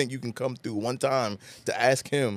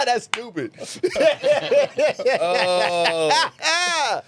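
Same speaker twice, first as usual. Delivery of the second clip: high laughing through the words; helpless laughter; real laughter; 12 semitones higher, 3 dB louder, much slower than usual. Real, not acted. Laughing at the imitation.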